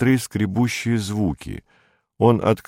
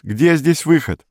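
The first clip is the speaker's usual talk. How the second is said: high, drawn-out desperate call; crying out; 8 semitones higher, 3 dB louder than usual. A narrator's reading. Perets asks the shop for the exit, crying out. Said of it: "крикнул Перец".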